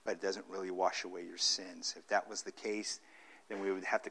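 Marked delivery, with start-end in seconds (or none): none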